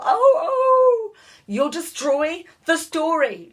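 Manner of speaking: high, loud crying-out; boy crying out as the book scrapes the page; anguished yell. A narrator's reading. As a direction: wail it in panic. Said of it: "Louis yelled".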